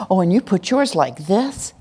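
normal talking voice

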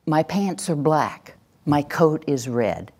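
slow, matter-of-fact voice of no doubt; assertive. Shown as a demonstration. Trainer demonstrating the voice of knowing to the class, assertive. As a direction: state it slowly as plain, certain fact. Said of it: "assertive voice is the voice of knowing".

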